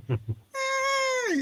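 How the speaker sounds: very high, thin scream; mock scream. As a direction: let out a wild high yelp. Repeats the son's scream.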